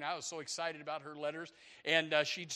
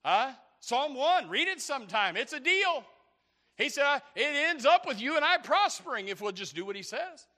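raised, loud, urgent exhortation; high-energy pulpit delivery; full-force preaching; 8 semitones higher, 8 dB louder than usual. Real, not acted. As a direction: exhort the congregation loudly.

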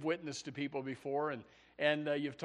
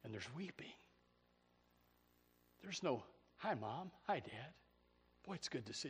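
hushed sorrow; 10 dB quieter than usual. Acted. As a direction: let the voice sink to a hush. Hushed grief.

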